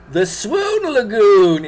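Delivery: slow and loud, announcing a grand name; grand title announcement. Pretend. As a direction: proclaim it like a movie title. Grandly naming the lake.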